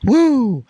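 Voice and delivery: high, loud "woo"; playful whoop. Real, not acted. Brushing off online haters, playful high whoop. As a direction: whoop it out in one burst. Gloating over haters gonna hate.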